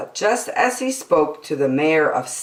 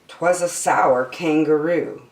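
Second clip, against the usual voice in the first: usual speaking voice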